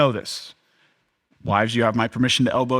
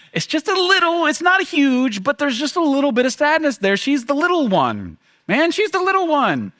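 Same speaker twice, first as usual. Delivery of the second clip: bittersweet; 13 semitones higher than usual. Real, not acted. Father's bittersweet feeling about daughter.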